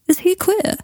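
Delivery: very high, incredulous query; surprised question. A narrator's reading. Malfoy hearing about an old schoolmate, surprised.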